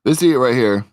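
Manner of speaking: usual speaking voice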